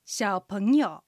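小朋友 in Mandarin, xiǎopéngyou with 小 in the third tone, 朋 rising in the second and 友 in the third; teacher models 小朋友 tone by tone; Chinese drill word. Shown as a demonstration. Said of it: "では、練習しましょう。". (小朋友を中国語で、小は三声、朋は二声で上げ、友は三声で)

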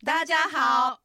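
"大家好" in bright native Mandarin; Mandarin greeting. Shown as a demonstration. Host opening the lesson, Mandarin greeting.

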